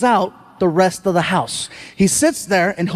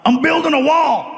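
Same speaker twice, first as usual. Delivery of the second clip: retold yell; 5 semitones higher than usual. Comic imitating Dave yelling from a closing door, mock shout. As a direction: call it out as Dave's parting shout. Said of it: "he yells out".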